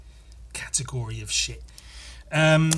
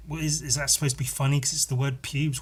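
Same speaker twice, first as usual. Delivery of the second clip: usual speaking voice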